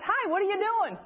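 high-pitched, pushy challenge; imitated in-your-face greeting. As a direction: confront them as a nosy young woman. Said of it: "gets right in my face".

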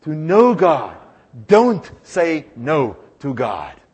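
slow, firm, every word weighted; slow emphatic motto. Shown as a demonstration. Preacher teaching the congregation a motto, slow and firm. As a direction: spell it out slowly to be memorized. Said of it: "say it with me again".

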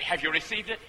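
normal talking voice

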